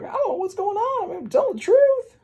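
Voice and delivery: very high squealed panic; panicked protest. Retold or imitated. Pinocchio insisting he is honest, high panicked squeal. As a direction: squeal it out in frantic self-defense.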